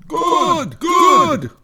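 high, loud, cheering creatures; excited creature cheer. Acted. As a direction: shout it like a crowd of spirits. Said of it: "cried the big Aguas in a chorus".

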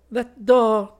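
put-on high, loud girlish voice, composed; a bright calm young woman; calm Colette. Colette calmly reassures the frightened men, high and loud. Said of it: "observed Colette calmly".

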